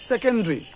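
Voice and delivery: সেকেন্ডারি squeezed to "se-ken-dri"; three-syllable "sekendri" for সেকেন্ডারি; correct pronunciation. Shown as a demonstration. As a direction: drop the vowel before ri in সেকেন্ডারি. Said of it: "কি হবে".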